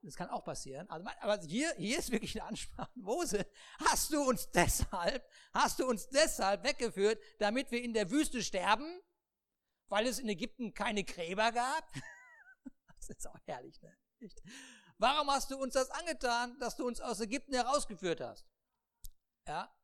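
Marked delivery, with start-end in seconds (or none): 2.9-11.9 loud, whiny accusation at Moses; complaining people. Acted. The people loudly reproach Moses for leading them out. 15.0-18.5 higher, louder reproach; complaining people. Acted. The people angrily ask Moses why he did this.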